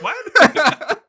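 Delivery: loud, high-pitched incredulous yelp; laughing disbelief. Acted. Reacting to the film's ending, laughing disbelief. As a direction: burst out in amused outrage. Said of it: "And I'm like".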